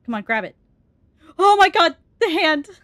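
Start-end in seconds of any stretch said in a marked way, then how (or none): 1.4-2.7 sudden loud scared cry, much higher; startled shriek. Real, not acted. Scared by the creepy hand, a loud startled shriek. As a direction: jump out of your skin and cry out.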